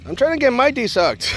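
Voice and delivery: high, exasperated let-down; indignant correction. Retold or imitated. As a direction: set a confused admirer straight. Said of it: "I'm like".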